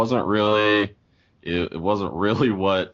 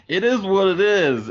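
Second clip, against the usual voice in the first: mock shrug; 12 semitones higher, 5 dB louder than usual. Mock shrug about the movie.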